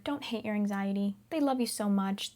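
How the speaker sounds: ordinary talk, nothing marked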